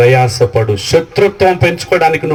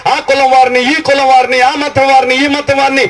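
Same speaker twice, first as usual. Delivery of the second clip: rhythmic preaching; 8 semitones higher than usual. Acted. Pastor declaring what the meetings are for, rhythmic preaching. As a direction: hammer each this-and-that in rhythm.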